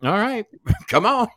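high, bright "come on then" taunt with a laugh; amused taunt; mock-casual dare. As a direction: laugh off the threat and invite him. Answering the knife threat.

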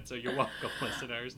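ordinary talk

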